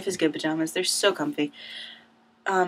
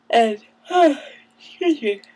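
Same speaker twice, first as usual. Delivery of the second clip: sighing yawn mid-sentence; 6 semitones higher, 6 dB louder than usual. Real, not acted. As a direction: yawn through the words. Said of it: "I'm so yawny".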